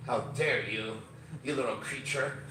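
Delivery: low, hushed disdain; disgusted disdain. Retold or imitated. Tall strangers looking at him with disgust, imitated low disdain. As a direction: look down on them, quietly appalled. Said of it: "disgust almost".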